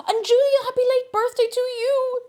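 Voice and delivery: beam a birthday wish to Julia; excited birthday wish. Real, not acted. Birthday shoutout.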